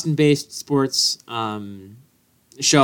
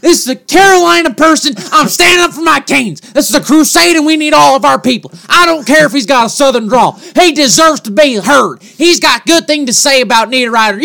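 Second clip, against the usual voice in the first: high-pitched furious rant with a Southern drawl; worked-up, drawling protest, pitched high; fired-up Southern drawl; 9 semitones higher, 4 dB louder than usual. Real, not acted. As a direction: shout indignantly in defense of your team. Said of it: "your Southern drawl kinda got you going".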